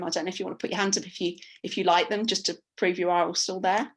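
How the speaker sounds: usual speaking voice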